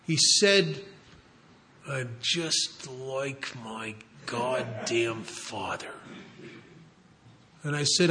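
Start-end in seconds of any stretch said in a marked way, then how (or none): 1.9-5.9 low, slow and hushed, as if out of an abyss; choked, pained whisper. Retold or imitated. Son admitting he is like his father, in a choked whisper. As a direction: force it out softly and slowly, choked with pain. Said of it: "this choked whisper as if out of some sort of abyss".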